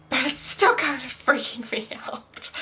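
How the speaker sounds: airy, giggling whisper; nervous laugh. Real, not acted. Confessing twin fears to viewers with a nervous whispered laugh. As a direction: laugh the worry off under your breath.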